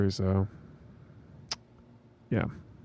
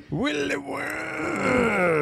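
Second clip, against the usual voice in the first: high, slow silly voice; 11 semitones higher, much slower than usual. Pretend. Silly drawn-out name.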